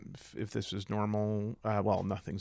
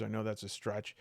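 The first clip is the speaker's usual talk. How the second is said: normal talking voice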